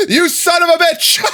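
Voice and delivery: high, loud laughing outburst; laughing curse. Real, not acted. Streamer cursing a game mishap, laughing shout.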